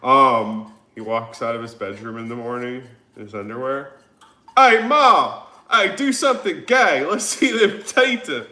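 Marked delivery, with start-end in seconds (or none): 4.6-8.5 hollering for Ma in a Southern twang; very loud, high Southern caricature; Southern accent. Acted. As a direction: yell it like a Southern son calling his mother. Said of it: "Maybe that's what he walks in".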